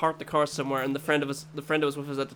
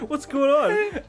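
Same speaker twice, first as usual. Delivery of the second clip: mock alarm; 11 semitones higher, 5 dB louder, slower than usual. Quoting self alarmed during the fall.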